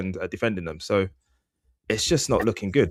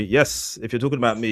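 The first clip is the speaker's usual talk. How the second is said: ordinary talk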